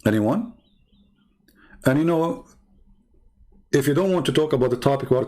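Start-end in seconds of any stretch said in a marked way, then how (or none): none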